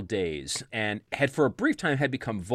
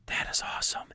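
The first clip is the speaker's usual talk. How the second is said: whispered, hushed amazement; awed whisper; 6 dB quieter than usual. Retold or imitated. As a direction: breathe it out in awe. Kids awed by the bolo.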